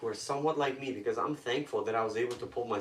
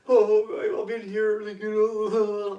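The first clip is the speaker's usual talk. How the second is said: high, loud mock wail; mock crying; 8 semitones higher, 8 dB louder, much slower than usual. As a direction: imitate a newcomer wailing loudly. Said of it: "you're like, you're crying".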